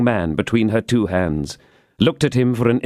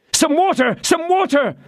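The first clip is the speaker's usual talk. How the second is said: high, urgent cry for water. Acted. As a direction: shout for water in panic. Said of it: "cried D'Artagnan".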